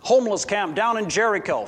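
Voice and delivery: ordinary talk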